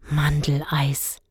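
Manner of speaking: slow, dreamy whispered Mandeleis; boy swooning over almond ice. A narrator's reading. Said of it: "schwärmte Dennis".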